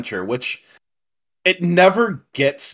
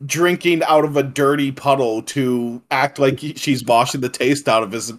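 normal talking voice

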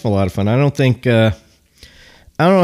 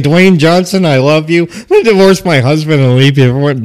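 imitating her tipsy, higher and louder; mock drunk wife; 5 semitones higher, 10 dB louder than usual. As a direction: ham up the slurry drunk declaration. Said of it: "If she's talking like this at the end of the show".

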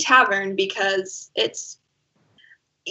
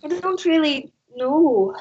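put-on Minnesotan accent; 6 semitones higher than usual. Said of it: "that was Minnesotan".

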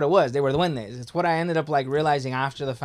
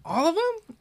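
shocked disbelief. Retold or imitated. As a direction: pitch jumps way up in mock shock. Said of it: "And he's like".

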